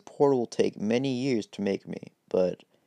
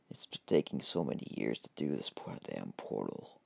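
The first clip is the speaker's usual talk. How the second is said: grumbling low; 10 semitones lower, 7 dB quieter than usual. Acted. Deku frustrated at slow portal.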